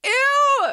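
high, loud shriek of disgust; a sharp, revolted squeal. Real, not acted. Revolted outburst at his word.

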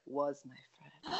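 soft mumbled punchline; under her breath. Retold or imitated. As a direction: drop the voice and mumble it. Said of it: "she's like".

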